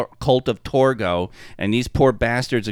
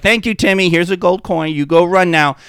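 higher, louder hurried brush-off; hasty brush-off; 6 semitones higher, 5 dB louder than usual. Retold or imitated. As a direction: shoo the kid away fast. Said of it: "Boface is like".

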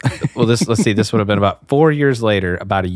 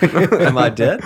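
laughing; 5 semitones higher, slower than usual. Real, not acted. Friend echoes, laughing.

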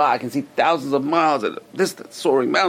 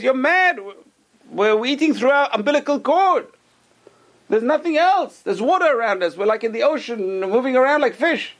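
scoffing twin; 13 semitones higher, 3 dB louder than usual. Acted. Sceptical twin argues back.

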